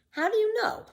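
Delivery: put-on high, curious boy voice; young boy Tommy. Tommy asks Pippi about walking backward, puzzled boy voice. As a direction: ask it like a puzzled small boy.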